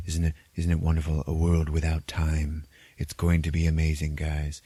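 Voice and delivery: lightly voiced villain savoring the wonder; mock-reverent retold villain speech; Caecilius. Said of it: "that is when Caecilius says".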